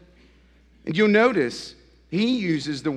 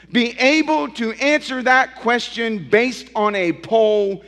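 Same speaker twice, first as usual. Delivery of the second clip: loud, rising sermon delivery, pressing each phrase; preaching at full force; 7 semitones higher, 8 dB louder than usual. Acted. Pastor challenging his congregation, preaching loud and high.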